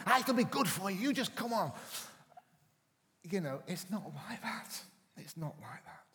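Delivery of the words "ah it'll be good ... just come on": high, loud cajoling parent; jolly the kids along, pitched up. Retold or imitated. Father cajoling bored children round the house, high and loud.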